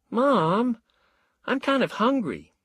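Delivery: put-on high childish plea to mom; a boy nagging his mother; child asking mom for food; whiny kid. A narrator's reading. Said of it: "Eddie said".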